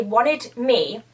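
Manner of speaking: usual speaking voice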